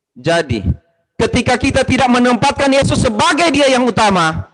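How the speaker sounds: high, loud preacher's call at full force; fiery preaching. Real, not acted. Preacher urging the congregation to put Jesus first, full-force preaching. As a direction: preach it out to the whole church, rising and loud.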